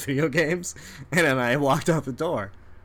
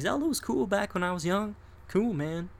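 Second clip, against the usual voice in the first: non-gamer dad; 7 semitones higher than usual. Retold or imitated. Acts out non-gamer dad's reply.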